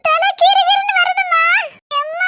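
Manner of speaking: high, laughing cry of dizziness; dizzy laughing. Acted. Mother tells daughter-in-law she feels dizzy, high and laughing. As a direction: laugh through the dizzy spell.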